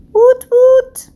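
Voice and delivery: high, loud "Woot, woot" squeal of joy; excited cheer. Real, not acted. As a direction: celebrate the clean knife, way up high. Cheering cake comes out clean.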